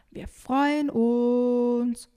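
loud, long held vowels; calling out. Acted. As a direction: shout it slowly across the lake. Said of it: "ruft Emily".

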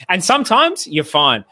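ordinary talk

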